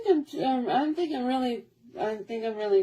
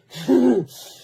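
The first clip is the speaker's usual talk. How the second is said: laugh; 8 dB louder than usual. Real, not acted. Laugh after mom's reaction.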